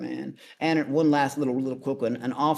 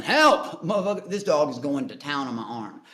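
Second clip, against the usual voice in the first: retold yell for help; 6 dB louder than usual. Dog on his arm, he yells to the other cops for help. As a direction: scream for help as the dog tears in. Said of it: "I'm yelling".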